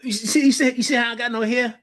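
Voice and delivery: high, fast joking complaint; mock exasperation. To the coach about pulling hair, high joking complaint. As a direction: ham up the frustration. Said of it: "I used to be like".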